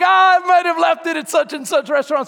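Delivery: high, loud realization; hammed-up frantic holler. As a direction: shout it out in mock panic. Still frantic about lost jacket.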